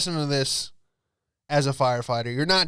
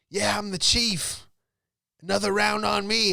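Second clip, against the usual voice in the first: fire chief voice; 7 semitones higher than usual. Retold or imitated. Fire chief at the bar buying a round, mock boozy. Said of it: "He would always be like".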